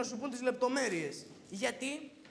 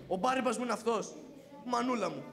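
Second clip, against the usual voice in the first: ordinary talk, nothing marked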